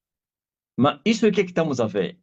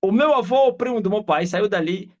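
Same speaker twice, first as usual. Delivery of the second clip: usual speaking voice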